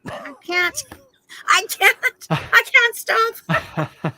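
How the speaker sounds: high, slow laughing confession. Retold or imitated. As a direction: giggle through it, helpless to stop. Admitting she can't stop.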